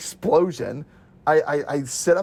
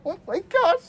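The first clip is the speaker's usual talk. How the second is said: mock shock. Hearing the building would be leveled.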